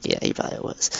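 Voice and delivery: near-whispered private agreement; very soft, voiceless aside to yourself; inner thought. Retold or imitated. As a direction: say it under your breath, as if thinking it. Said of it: "in my head, I was like".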